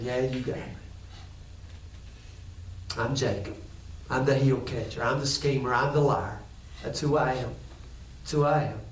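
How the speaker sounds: low, hushed, defeated; broken, murmured confession to God; whispered confession. Acted. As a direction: admit it with head hung low. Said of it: "He whispered it back, his head down".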